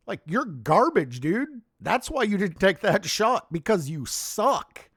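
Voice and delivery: heated, rising rant; really fed up, let it out; real anger at a player; angry outburst. Real, not acted. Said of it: "Sorry, I'm pissed off".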